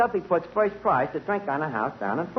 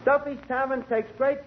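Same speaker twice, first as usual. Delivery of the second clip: higher-pitched showman announcement; announcer intro; 5 semitones higher than usual. Acted. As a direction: present the star grandly into the mic. Introduces singer on microphone.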